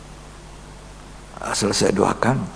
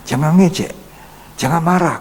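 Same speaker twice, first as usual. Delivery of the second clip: stern warning; 8 semitones higher, 5 dB louder than usual. Real, not acted. Preacher warning congregation.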